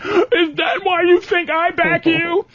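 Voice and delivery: a hammed-up, mock-hurt girlfriend; imitating hurt girlfriend. Girlfriend offended by his joke, mock high, slow and wounded. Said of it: "And then I said".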